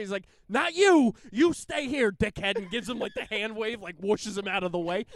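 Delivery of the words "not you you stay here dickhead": high, loud Philly brush-off; Philly accent. Retold or imitated. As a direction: wave him off in a thick Delco accent. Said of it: "I think the accent is what makes it funny".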